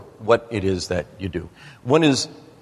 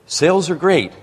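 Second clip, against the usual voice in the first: retold breezy brag, pitched up and a bit louder; upbeat boast; 8 semitones higher, 3 dB louder than usual. Founder answering how sales are, with a mock upbeat brag. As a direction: mimic a founder's cheerful non-answer. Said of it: "He said".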